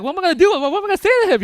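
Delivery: loud, high comic panic; freaking out. Retold or imitated. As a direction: fret out loud at full volume. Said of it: "I was freaking out".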